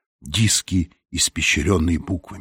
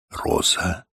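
hushed, breath-led murmur to himself; whisper; 5 dB quieter than usual. A narrator's reading. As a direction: whisper it softly, almost voiceless. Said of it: "прошептал он".

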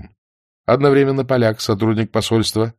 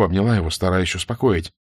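plain reading voice

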